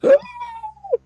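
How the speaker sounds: sharp, high startled gasp; robot gasp. Acted. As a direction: gasp in shock like a little robot. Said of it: "The bot goes".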